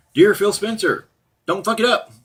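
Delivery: a hammed-up formal address to Phil Spencer; mock open letter. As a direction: read the letter out like a grand announcement. Reading out his open letter.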